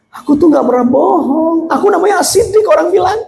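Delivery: high, climbing protest; protesting Abu Bakar. Acted. Abu Bakar telling the blind man he never lies, protesting. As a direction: insist in a rising high voice.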